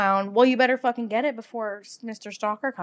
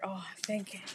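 soft whispered thanks; 2 semitones lower, 12 dB quieter than usual. Real, not acted.

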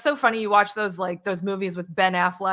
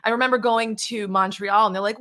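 ordinary talk, nothing marked

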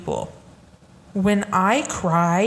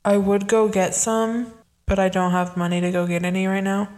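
ordinary talk, nothing marked

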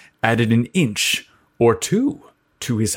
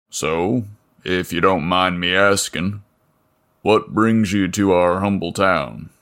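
a lower, easygoing small-town mechanic; burly mechanic; 4 semitones lower than usual. Acted. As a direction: chat lazily like the local big man. Voices Leo.

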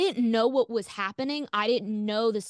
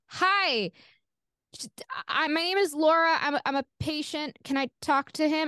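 higher, hesitant, overly polite; nervous polite caller; 6 semitones higher than usual. Retold or imitated. Young patient asking the secretary for the doctor, nervous and higher. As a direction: sound small and unsure on the phone. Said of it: "And I was like".

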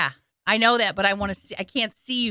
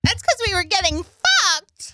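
laughing shout; 10 semitones higher, 3 dB louder than usual. Real, not acted. Laughing outburst among friends.

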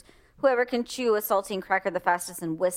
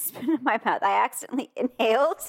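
ordinary talk, nothing marked

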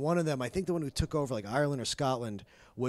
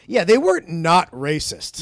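sarcastic; 5 semitones higher, 13 dB louder than usual. Acted. Mock sarcastic correction.

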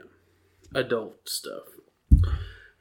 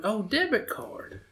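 mimicking wife; 11 semitones higher, 4 dB quieter than usual. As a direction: pitch up and draw out her dawning realization. Voicing wife's realization.

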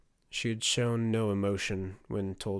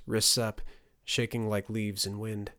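ordinary delivery

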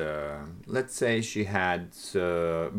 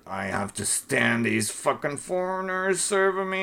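put-on grumpy old man; 11 semitones higher than usual. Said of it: "Something like".

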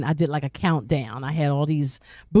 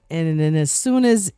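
usual speaking voice

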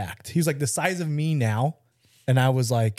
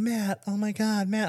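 high, slow, gushing "Matt"; mock swooning girls; 10 semitones higher, slower than usual. Girls at the party fawning after the knockout, mock gush. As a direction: imitate the girls fawning over him. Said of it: "all the girls going".

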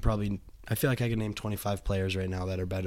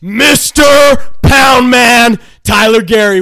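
much higher and much louder, shouted like a boxing ring announcer; 13 semitones higher, 19 dB louder, slower than usual. Acted. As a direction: bellow the guest's name to a crowd. Shouting the guest's introduction.

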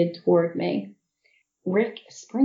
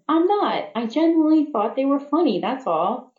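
protesting; 7 semitones higher than usual. Acted. Protesting being teased.

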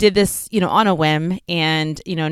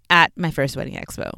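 ordinary talk, nothing marked

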